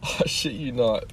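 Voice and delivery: ordinary delivery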